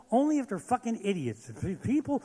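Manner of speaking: ordinary talk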